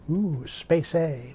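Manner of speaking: slow, hushed, wide-eyed ooh; intrigued listener voice. Pretend. Voices a curious listener's reaction.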